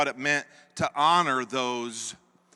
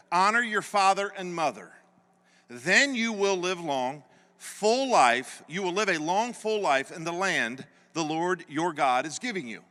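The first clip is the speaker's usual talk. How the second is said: measured, preaching read of the verse; scripture reading; 5 semitones higher, 4 dB louder than usual. Acted. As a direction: read the commandment with weight. Reads scripture in a sermon.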